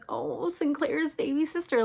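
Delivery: high-pitched, mock-sweet condescension; condescending. Eddie sizing up Lucas's little sister, condescending. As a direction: talk down to a kid, pitched high. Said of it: "a little bit condescending with her".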